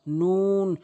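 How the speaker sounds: "নুন" long, with rounded lips; rounded-mouth noon. Shown as a demonstration. Teacher showing the correct letter, long rounded "নুন". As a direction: stretch the u of "নুন" slowly. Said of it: "মুখটা গোলাকার করে".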